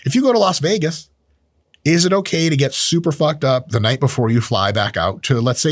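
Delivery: ordinary talk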